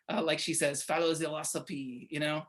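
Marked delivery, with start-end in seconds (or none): 0.8-2.1 soft, mock French accent. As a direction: say it lightly like a French chef. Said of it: "You can say it with a French accent".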